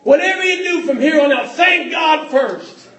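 full-force revival shout; shouted repeat. Acted. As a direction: build the repeat into a cry. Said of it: "repeated loudly after quiet take".

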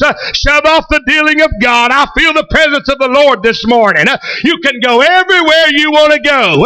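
rapid shouted pulpit rhythm, climbing to a peak; full-force preaching. Real, not acted. Preacher confronting those who go wherever they want, full-force preaching. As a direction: drive the warning home with breathy "uh" punches.

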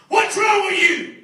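high, sharp challenge; loud-ish high retort, acted out; confrontational challenge. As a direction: square up and demand an answer. Young man confronts him, retold.

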